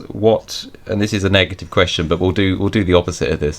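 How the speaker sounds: usual speaking voice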